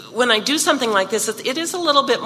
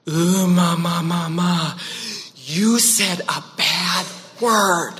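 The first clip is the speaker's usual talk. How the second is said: lightly voiced appalled toddler, slow; a hint of a shocked little boy, drawn out; toddler son; slower than usual. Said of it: "And he said".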